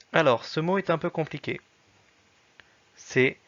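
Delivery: ordinary talk, nothing marked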